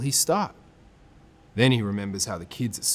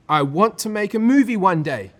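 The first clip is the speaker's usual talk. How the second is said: high, louder excited answer; eager kid; 9 semitones higher, 5 dB louder than usual. Acted. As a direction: blurt out the dream.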